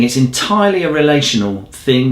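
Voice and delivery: ordinary talk, nothing marked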